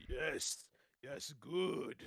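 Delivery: a hint of sinister Palpatine glee; lightly hissed evil approval; Palpatine-like dark side. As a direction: savour it like a scheming emperor. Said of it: "the bad side of Tobias ... Palpatine".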